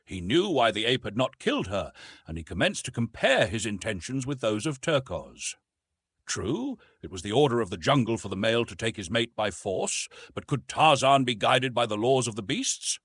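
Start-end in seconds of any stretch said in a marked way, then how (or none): none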